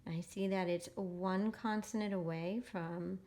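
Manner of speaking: ordinary talk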